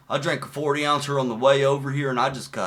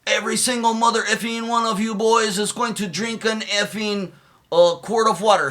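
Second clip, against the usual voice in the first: higher, barked drill sergeant order; 8 semitones higher, 4 dB louder than usual. Retold or imitated. Recruit retelling the sergeants ordering water on the bus, mock bark. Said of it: "they were like".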